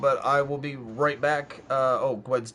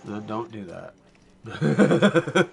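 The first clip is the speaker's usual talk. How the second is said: slow, laughing through words; 3 dB louder, much slower than usual. Real, not acted. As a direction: laugh while saying it. Jokes about peanut allergy.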